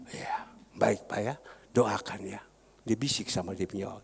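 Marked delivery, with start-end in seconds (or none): 0.1-2.4 retold hushed agreement; whispered reply. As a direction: breathe it softly, almost secret. Friend whispers reply to pastor.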